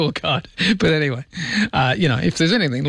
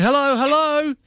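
high, far-carrying mock call for a dog; a neighbour calling for the dog, lightly imitated; calling hello; 11 semitones higher than usual. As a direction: call out like searching for a lost dog.

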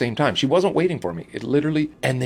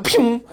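high-pitched shot sound effect; imitated "pew" noise; 9 semitones higher, 4 dB louder than usual. Comedian firing back at the woman, high "pew" noise. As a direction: make a quick high "pew". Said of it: "I just was like".

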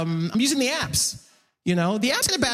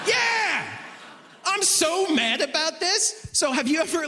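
ordinary talk, nothing marked

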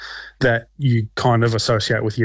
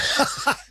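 short unvoiced breathy laugh; 4 dB quieter than usual. Real, not acted. Laughing off the host's masochist jibe, breathy laugh. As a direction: let out a quick airy laugh.

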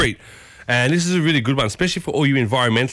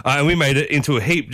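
ordinary delivery

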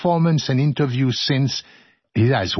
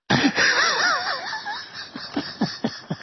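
shrill laughing squeal; high squealing laugh; 5 dB quieter than usual. Real, not acted. Laughs after the nerd joke.

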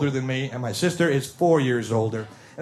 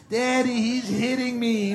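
put-on high, slow whiny child voice; whiny little sister; 8 semitones higher, slower than usual. Little sister tattling to daddy, high whine. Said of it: "little sister starts to tear".

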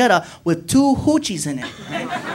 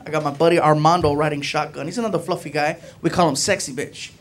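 usual speaking voice

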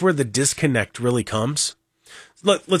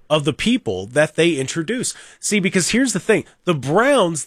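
ordinary delivery

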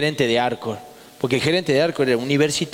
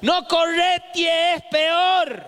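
voz aguda y lenta de vieja chillona; imitá a la vieja que grita desde la vereda; viejas del barrio; 12 semitones higher, much slower than usual. Retold or imitated. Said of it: "las viejas uñudas esas que estaban barriendo a la mañana decían". (vieja barriendo que le grita al chico, voz aguda y lenta)

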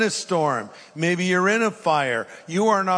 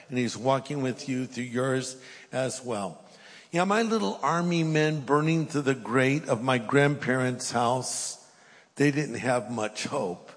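usual speaking voice

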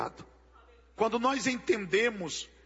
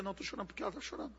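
softer, fast, hushed mock reply; imitating a tearful woman; 13 dB quieter, much faster than usual. Preacher mocks a woman who cries along, soft and fast. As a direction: rattle it off softly like a silly tearful woman. Mock dialogue about crying women.

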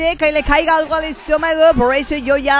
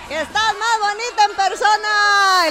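quoted fan; 5 semitones higher than usual. Retold or imitated. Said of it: "Y le dije".

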